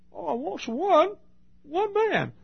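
high, slow, drawn-out cry; soaring plea. Real, not acted. Preacher voicing God's search for one man, high and slow.

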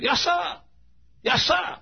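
very high-pitched Southern reply; imitated Southern dialect. Fellow at the church answering the caller, high Southern dialect. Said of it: "this is the way he talked".

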